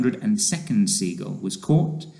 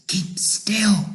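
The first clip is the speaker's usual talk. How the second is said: high, urgent stage whisper; breath-voiced hushed command; 9 semitones higher than usual. A narrator's reading. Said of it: "whispered James".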